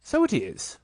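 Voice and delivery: a lightly voiced busy Rabbit. Rabbit voice in story.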